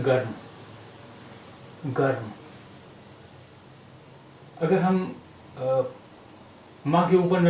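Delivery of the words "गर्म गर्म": गर्म with the r closing the first syllable; गर्म clipped, r straight into m; say garm. Shown as a demonstration. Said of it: "ये है रा, ये माँ के ऊपर लगा हुआ है".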